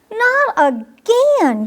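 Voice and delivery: high, slow gasping not again; gasped dismay. Acted. Squirrel sees a third leaf fall, high slow gasp. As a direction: breathe it out in dismay. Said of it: "gasped Pip".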